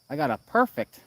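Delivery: sudden high pleased squeal; delighted at the perfect score; excited. Real, not acted. Streamer thrilled at getting a perfect, excited.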